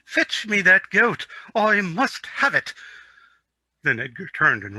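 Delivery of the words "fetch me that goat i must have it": high, loud, slow royal command; authoritative prince. Acted. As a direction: order the servant like a spoiled royal. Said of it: "in his most authoritative voice".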